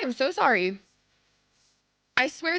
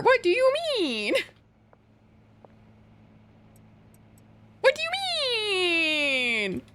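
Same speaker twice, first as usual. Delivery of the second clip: mock offended; 9 semitones higher than usual.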